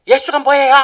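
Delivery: defiant schoolgirl. Retold or imitated. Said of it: "막 이러면서 막 대드는 애들이".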